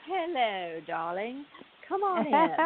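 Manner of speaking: lightly voiced Southern belle hostess; a hint of a grand old-time lady welcoming guests; breathy vintage purr. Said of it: "I would be like".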